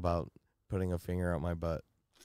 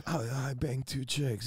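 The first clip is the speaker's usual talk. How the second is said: casual confession; 3 semitones higher, 6 dB quieter than usual. Retold or imitated. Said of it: "I'm like".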